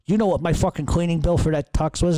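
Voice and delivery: mildly acted irritated demand; irate brother. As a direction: retell an irate phone complaint. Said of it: "he calls me irate".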